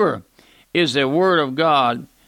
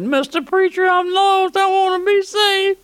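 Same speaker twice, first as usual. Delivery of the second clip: imitated lost churchgoer. Preacher voicing a congregant crying out in service, high pleading. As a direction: cry out high like a desperate sinner in the pew. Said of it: "someone stood up ... and said".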